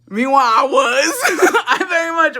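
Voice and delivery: high, loud, drawn-out giggly admission; laughing confession. Real, not acted. Storyteller admits she was lying to dad, laughing. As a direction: burst out laughing while owning up.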